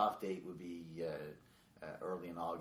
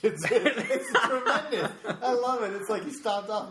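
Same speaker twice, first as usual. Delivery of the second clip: high, loud, slow through laughter; laughing; 14 semitones higher, 12 dB louder than usual. Real, not acted. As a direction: gush it while laughing hard. Laughing hard at accent.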